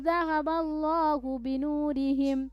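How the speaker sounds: high, slow melodic recitation; Quran recitation. Shown as a demonstration. Teacher recites the passage for students to follow. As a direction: chant slowly and lift the long notes.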